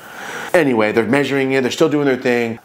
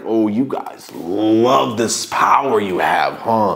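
mocking; 2 semitones lower, much slower than usual. Mocking power-loving cops.

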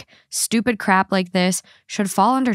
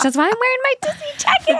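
excited; faster than usual. Real, not acted. Excited, high and fast.

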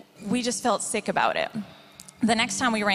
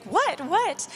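eager surprise; 6 semitones higher than usual. Retold or imitated. Acts out couple's eager reaction.

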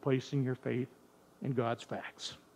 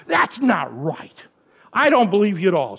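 little sister's defiant denial, louder and higher; defiant protest; 5 semitones higher, 10 dB louder than usual. Retold or imitated. Little sister insisting to her brother it's not true, defiantly. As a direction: push back hard, refusing to believe it. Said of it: "full of defiance".

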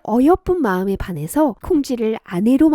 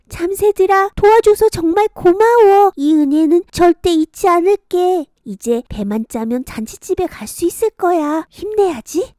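put-on high, louder delighted girl; grateful girl; 7 semitones higher, 6 dB louder than usual. Kongjwi thanking the sparrows, high delighted voice. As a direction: thank them with joy.

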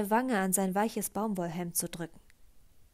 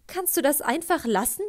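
exasperated plea; 5 semitones higher, 6 dB louder than usual. A narrator's reading. Emotional plea to Kate.

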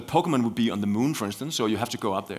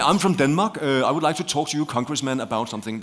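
light put-on Danish accent, pitched higher; 4 semitones higher, 4 dB louder than usual. Shown as a demonstration. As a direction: play up a Danish accent for congress. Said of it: "With Danish accent".

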